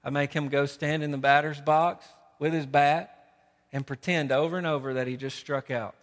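ordinary delivery

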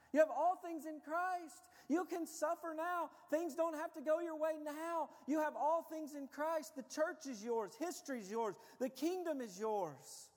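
high, forceful proclamation; full-force preaching. Real, not acted. Preacher proclaiming to congregation what is theirs, full force. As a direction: ring out each short declaration.

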